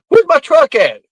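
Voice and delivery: high put-on demanding voice; mock demand. Shelby coming home demanding his truck, high mock indignation.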